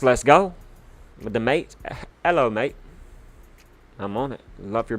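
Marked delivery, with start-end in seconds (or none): 0.0-0.5 loud rising hype cheer; rising celebratory let's go; hype shout. Real, not acted. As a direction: shout it out to pump up the chat. Hyped cheer for viewer.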